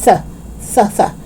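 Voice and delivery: "Th" as a bare voiceless puff; isolated TH. Shown as a demonstration. Teacher isolating the ending for learners, bare "Th". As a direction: push air over the tongue, "Th". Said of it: "It's strength".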